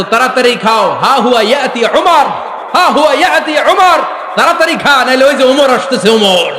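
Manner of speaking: high, loud mock warning to a child; loud, urgent scare-call, stretching the name out; mother scaring children. Medina mothers scaring children with Omar. (ভয় দেখিয়ে মায়ের জোর হাঁক)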